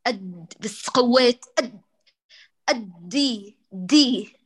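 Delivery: «أدي دي» slow and pushed, the air bursting out; «أد» too hard, so the د splits into «دي»; over-forced split ad. Shown as a demonstration. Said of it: "تدفق الهواء".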